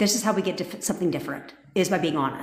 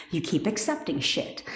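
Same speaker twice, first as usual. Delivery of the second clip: normal talking voice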